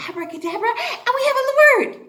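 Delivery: fast, loud and high; excited. Acted. Teacher excitedly explains word magic with abracadabra. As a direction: rush it like a magic spell.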